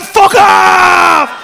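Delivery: long, high, full-volume yell; blast-off shout. Retold or imitated. Teller blasting out of his body, huge shout. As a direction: holler it as if launched skyward.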